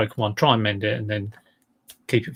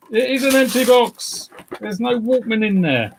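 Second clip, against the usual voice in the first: high, loud disbelief at the empty box; real surprise; 10 semitones higher, 4 dB louder than usual. Real, not acted. Finding the Walkman box empty, shocked squeal. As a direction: squeal it out as the discovery lands.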